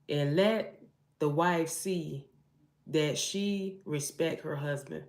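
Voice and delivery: slow, measured Bible reading; scripture reading. Acted. Reading Ephesians to viewers, slow and solemn. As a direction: read the verse slowly and solemnly.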